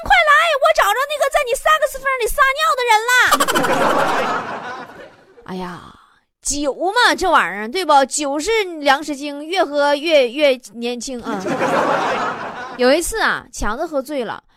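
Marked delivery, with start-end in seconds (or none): 0.0-4.8 much higher, much louder yell; shout. Acted. Waiter yelling for the boss across the restaurant, loud shout. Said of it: "大喊一声". 11.5-12.7 short unvoiced chuckle; airy laugh. Real, not acted. Host chuckling about drinking, airy laugh.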